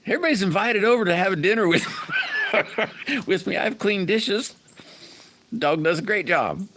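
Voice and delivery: giggling through the words, pitched high and fast; laughing while talking. Real, not acted. Joking to chat about the dog licking dishes, laughing. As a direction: laugh your way through the joke.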